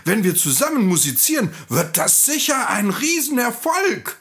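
high, lively donkey pep talk; rally the friend, climbing to an excited high finish; upbeat donkey. Acted. Donkey talks the rooster into coming along, enthusiastic.

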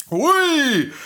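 very high drawn-out exclamation; surprised whoop. Acted. Donkey hears the rooster screaming, astonished whoop.

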